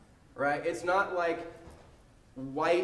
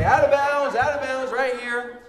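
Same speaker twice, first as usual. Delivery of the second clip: higher, loud call of out of bounds; firm shouted ruling; loud ref call; 8 semitones higher, 9 dB louder than usual. Retold or imitated. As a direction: call it for the whole gym to hear. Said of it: "And I say".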